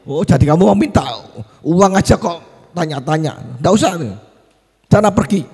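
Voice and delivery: high, loud dismissive scolding; angry father. Acted. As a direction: send the child away in a temper. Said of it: "Loh marah tambah papa ya".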